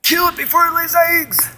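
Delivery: high panicked yell to kill it; high frantic cry to kill the thing; panicked shout. Acted. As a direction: scream it in panic at the creature. Acted monster scene.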